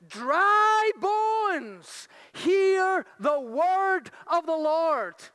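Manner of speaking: commanding proclamation; proclaiming. Shown as a demonstration. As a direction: call out to the dead bones. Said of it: "say to them".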